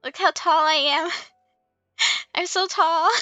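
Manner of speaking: normal talking voice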